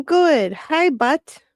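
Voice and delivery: high, loud teasing hello; playful greeting. Real, not acted. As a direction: tease the viewer cheerfully.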